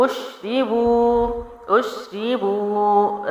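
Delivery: slow drawn-out tajweed chant; Quran recitation. Shown as a demonstration. Teacher reciting to students in slow tajweed style. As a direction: recite it slowly, stretching the vowels. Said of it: "را زیر پڑی حرکت جلدی چھوڑا".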